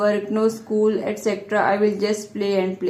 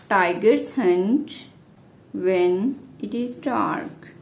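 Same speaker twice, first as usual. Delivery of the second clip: slow, broken into small pieces; dictating; 3 semitones lower, much slower than usual. Real, not acted. Teacher giving students the tiger answer, dictating slowly. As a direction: give each word time to be written.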